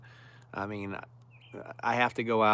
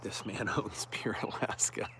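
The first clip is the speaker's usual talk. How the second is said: laughing whisper; 5 dB quieter than usual. Real, not acted. Laughing aside.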